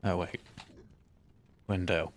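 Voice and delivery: usual speaking voice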